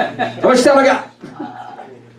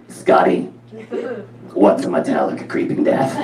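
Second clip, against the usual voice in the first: flatlined, croaky mom voice; imitating a friend's flat-voiced mother; Rona; 2 semitones lower than usual. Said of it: "this is how she talked".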